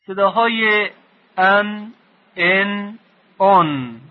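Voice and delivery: "ان" sung out high and held; teacher demonstrating the an sound; tanvin sounds. Shown as a demonstration. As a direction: voice each tanvin sound clearly. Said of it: "که حتماً اونها رو شنیدید".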